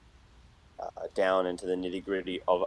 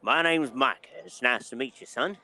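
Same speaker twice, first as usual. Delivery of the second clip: bartender voice; 4 semitones higher than usual. Acted.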